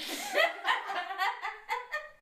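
high, loud burst of laughter; big laugh. Real, not acted. Cracking up at the best-a-man-can-get line, high laugh. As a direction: burst out laughing, pitch jumping up.

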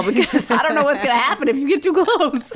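high, laughing mock warning. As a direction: warn him off, giggling. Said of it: "I was like".

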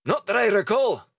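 high gruff sailor voice; Dugald voice. Acted. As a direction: answer the captain smartly. Dugald answers captain.

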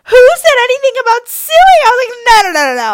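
very high, loud squeal; hammed-up innocent protest; frantic denial. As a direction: backpedal in comic panic. Frantically denies threatening to sue.

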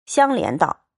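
ordinary delivery, nothing marked

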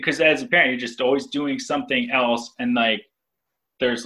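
usual speaking voice

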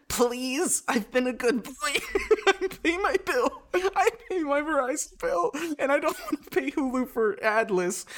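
pleading high through laughter; laughing plea. Real, not acted. Begging Verizon for ad-free Hulu, pleading high and laughing. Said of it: "Dear Verizon".

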